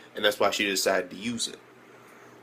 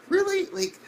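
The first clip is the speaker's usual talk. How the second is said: exasperated disbelief. Retold or imitated. Retelling his reaction to viewers, exasperated disbelief.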